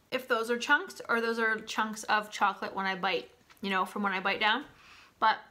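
chewing while talking; speaking between bites; mouth full. Real, not acted. Seen 0: She holds a bitten bar, chews between words, and speaks mid-chew.

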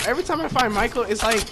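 normal talking voice

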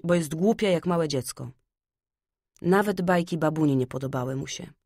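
ordinary delivery, nothing marked

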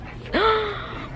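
high, soft intake of breath; delighted gasp. Real, not acted. Shopper spotting another pretty one, delighted gasp. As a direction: gasp at a lovely find.

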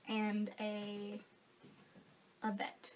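ordinary delivery

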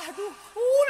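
a high yelp of pain, half laughing; pained ouch. Retold or imitated. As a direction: cry out as the baby bites. Mother bitten by baby. (jeritan kecil ibu digigit bayi)

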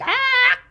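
very high laughing shriek; mock baby scream. Imitating the baby's scream while laughing.

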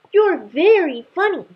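high, loud delighted squeal; gleeful cub. Acted. Cub teasing the startled dragon, high delighted shout. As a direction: squeal it with childish glee. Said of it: "burst out the small lion cub".

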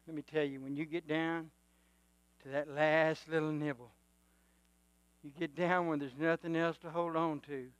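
usual speaking voice

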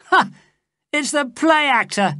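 high, loud scornful jeer; mocking cry. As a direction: taunt him with a laugh. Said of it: "he cried".

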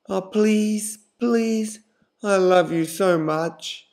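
put-on begging boyfriend. A narrator's reading. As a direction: beg her, stretching every please.

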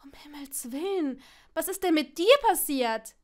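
gasped alarm rising to a loud question; aghast outcry at a friend's state; shocked concern. A narrator's reading. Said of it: "aus dem Schimpfen wurde ein".

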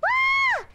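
very high, loud scream of surprise; startled squeal. Real, not acted. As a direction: shriek at a sudden surprise. Surprised by an incoming raid.

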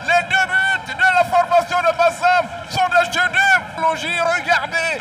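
drawn-out, high, loud goal call; excited sports commentary. Real, not acted. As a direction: roar the scorer's name, stretching it.

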